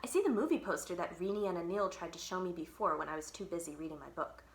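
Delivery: usual speaking voice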